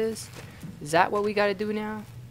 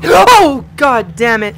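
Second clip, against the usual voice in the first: startled; 8 semitones higher, 16 dB louder, much slower than usual. Real, not acted. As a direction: gasp and high startled shout. Jump scare.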